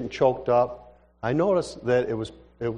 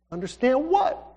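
high, rising puzzlement; baffled question; 9 semitones higher than usual. Acted. Young man asks the crying woman, high baffled question. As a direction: pitch it up on "what", asking in bafflement.

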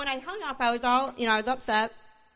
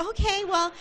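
higher, sweetly polite pleading; mock sugary politeness, pitched up; trying to be nice; 8 semitones higher than usual. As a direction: put on a nice voice to win her over. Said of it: "I'm trying to be nice".

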